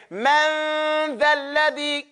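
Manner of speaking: Man dhal ladhi drawn out slowly, mouth wide open; slow teaching demo. Shown as a demonstration. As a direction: exaggerate the mouth for learners. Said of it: "You have to open your mouth".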